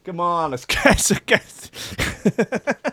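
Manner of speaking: put-on losing the Italian American accent to giggles; mock accent breaking into laughter. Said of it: "he might be American Italian".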